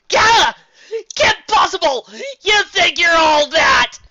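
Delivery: very high, loud cartoon shout; thwarted villain's shrieking tantrum; outraged villain scream. A narrator's reading. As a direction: scream it, stretching the last words. Villain screams at his foe.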